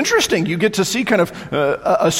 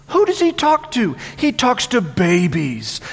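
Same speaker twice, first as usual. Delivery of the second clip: mocking question; 5 semitones higher than usual. Mocking the prophet.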